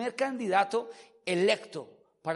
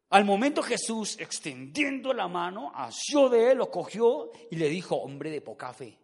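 stern corrected version. Acted. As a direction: read it forcefully, then reprove the doubter. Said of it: "Ese texto dice todo lo contrario". (lectura enérgica que termina en reproche)

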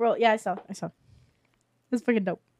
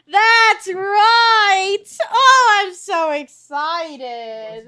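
excited guard; 6 semitones higher, 4 dB louder, much slower than usual. Acted.

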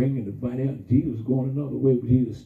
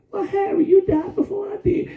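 mock risen dead woman; 3 dB louder than usual. Voicing risen dead folks, very high.

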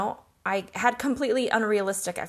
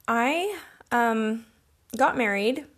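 ordinary delivery

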